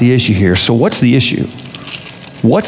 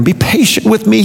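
higher-pitched, understated plea; begging; 8 semitones higher than usual. A narrator's reading. Servant kneeling before the master, begging. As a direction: plead lightly, pitched up. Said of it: "he begged".

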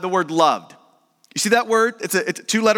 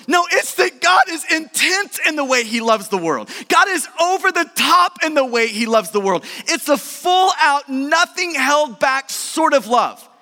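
higher and emphatic, slowing on "nothing held back"; intense emphasis; 4 semitones higher than usual. Acted. Preacher insisting God's love is intense, rising. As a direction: press hard on "intense" and "over the top". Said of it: "front-loading that verse with emphasis".

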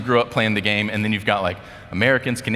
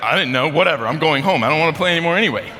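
hurried, raised-pitch petulant retort; mock huffy; 5 semitones higher, 4 dB louder, faster than usual. Scolded newcomer quitting the game, mock huffy. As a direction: storm off in a huff, speeding up. Imagined sulky reply to being scolded.

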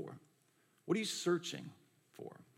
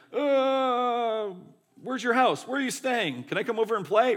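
put-on higher, childlike, nervous blurting; awkward blurted reply; 7 semitones higher, 4 dB louder than usual. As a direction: ask like a starstruck kid wanting a playdate. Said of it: "You just kind of blurt out something".

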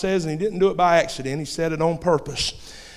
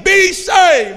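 loud, raised preacher's roll building to a shout; full-force preaching; 4 semitones higher, 10 dB louder than usual. Acted. Preacher proclaiming whom Jesus came for, full-force preaching rising to a shout. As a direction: hammer each phrase, then stretch the climax high.

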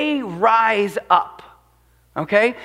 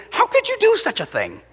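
high, incredulous scoffing; pitched-up scornful ridicule; mocking disbelief; 6 semitones higher than usual. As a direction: ask it like they've lost their minds. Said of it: "the psalm starts out with this".

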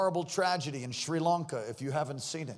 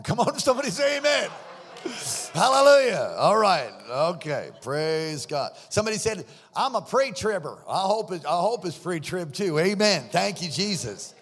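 loud, fast, high preaching shout; preaching at full force; 4 semitones higher, 8 dB louder than usual. Real, not acted. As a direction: whip up the congregation. Rallying the congregation.

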